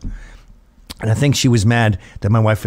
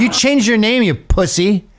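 much higher, hammed-up ranting woman; drunk angry woman; 8 semitones higher than usual. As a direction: shriek the insults, higher.

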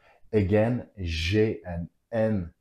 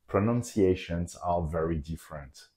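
ordinary talk

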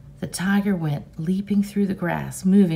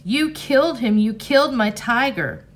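higher, loud angry accusation; angry screaming; 5 semitones higher, 4 dB louder than usual. Acted. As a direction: keep screaming at your father. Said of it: "Rob screamed".

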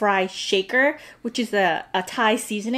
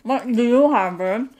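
chewing while humming; talking around food; mouth full of fries; faster than usual. Real, not acted. Seen 0: Woman chews a fry, then stuffs more fries into her mouth.

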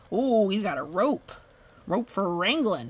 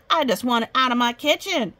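mock-frightened mayor, higher and louder; panicky mayor; 5 semitones higher, 6 dB louder than usual. A narrator's reading. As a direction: cry it out as a rattled mayor. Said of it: "The mayor trembled".

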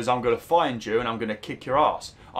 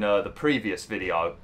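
ordinary talk

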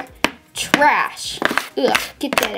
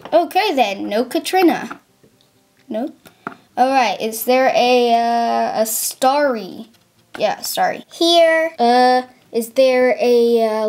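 usual speaking voice

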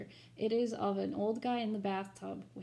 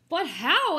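alarmed question; 6 semitones higher, 11 dB louder than usual. Acted. Baker asks in alarm.

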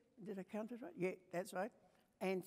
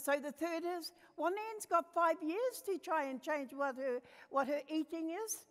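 put-on child's voice; 6 semitones higher than usual. Grandchild announces the third article.

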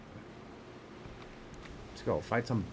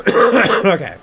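loud okay; 6 semitones higher, 12 dB louder than usual. Real, not acted. Sudden loud okay after cough.

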